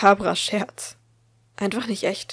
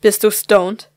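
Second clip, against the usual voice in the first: louder, stern demand with stress on du; stern interrogation; 11 dB louder, slower than usual. Acted. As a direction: grill her: are YOU stoned. Mother interrogating daughter, slapping.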